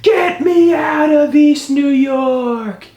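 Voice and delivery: high-pitched drawn-out mock scream. Storyteller begging to leave, high scream. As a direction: wail it out in acted panic. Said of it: "And I scream".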